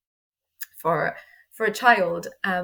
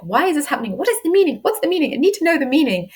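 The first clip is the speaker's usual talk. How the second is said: higher, louder and urgent; a self pushing for answers, higher and louder; frantic demanding; 7 semitones higher, 4 dB louder, faster than usual. Retold or imitated. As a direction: demand an answer, getting desperate. Frantic inner demand for meaning.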